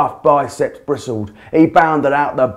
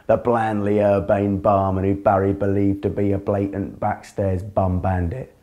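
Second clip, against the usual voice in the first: usual speaking voice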